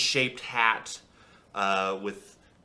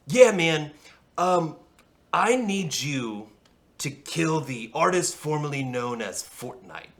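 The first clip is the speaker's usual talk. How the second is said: laid-back dude; 6 semitones higher, 6 dB louder than usual. Acted. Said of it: "And he goes".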